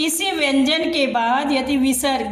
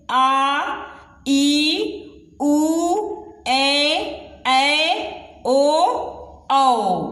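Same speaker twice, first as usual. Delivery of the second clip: long vowels drawn out; much slower than usual. Shown as a demonstration. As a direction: stretch every long vowel. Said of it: "हमें खींचना पड़ता है लय". (स्वरों को खींचकर बोलें)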